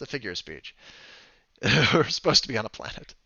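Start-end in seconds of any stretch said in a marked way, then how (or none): 1.6-3.1 laughing. Real, not acted. Laughing at own slip.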